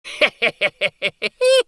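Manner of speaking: high, loud gleeful laugh; cackling laugh. A narrator's reading. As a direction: laugh at the king. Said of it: "laughed Uncle Archibald".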